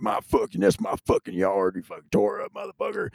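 high, strained imitation of a furious neighbour; mock angry homeowner. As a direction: squeak out a hammed-up owner's complaint. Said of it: "I'm like".

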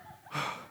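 long audible breath out; acted sigh of relief; exhaling sigh. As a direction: exhale as if the crisis is over. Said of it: "And I exhale".

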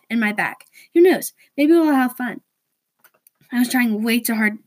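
usual speaking voice

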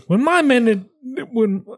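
high-pitched Australian accent attempt; shaky-on-target Australian that sounds British. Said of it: "Sounds Australian".

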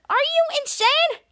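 high, drawn-out incredulous question; squeaky-high mock disbelief, slow; acted disbelief. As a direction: imitate someone appalled by a crazy plan. Acted disbelieving reply in story.

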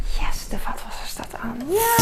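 real hushed whisper. Real, not acted. Child asleep, keeping quiet.

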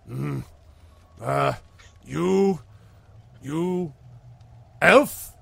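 halting troll working out a question; word by word, climbing to a loud, high last word; puzzled troll. Acted. Troll sizing up a stranger, halting and questioning. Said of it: "he looks at you and he goes".

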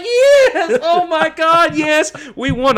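high, loud, laughing and near tears, screaming yes; overjoyed fan yelling yes with a catch of tears in the voice; ecstatic victory yell. Retold or imitated. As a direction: relive the winning moment, screaming with joy. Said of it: "I can feel me get emotional now".

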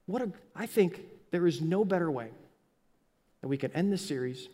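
normal talking voice

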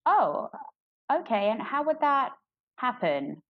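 high, taken-aback "oh, okay"; mock shock. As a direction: imitate someone thrown by a blunt offer. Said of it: "They're usually pretty shocked".

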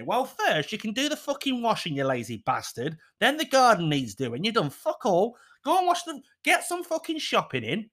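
high, mock-angry nagging rant; wife reeling off chores, mock nagging. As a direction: scold him like a fed-up wife, pitch climbing.

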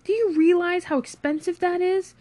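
high, indignant, strained-up cry; outraged scream. Acted. Friend over wasted minibar vodka, outraged scream. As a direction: protest at a screaming pitch. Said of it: "screamed Dave".

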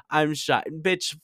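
normal talking voice

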